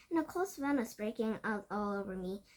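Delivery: usual speaking voice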